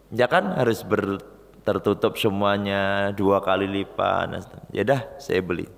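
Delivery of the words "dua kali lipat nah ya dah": plain reading voice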